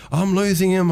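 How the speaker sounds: high-pitched mock panic; mock alarm. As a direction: cry it out like a rescuer losing someone. Acts out Jack's alarm over victim.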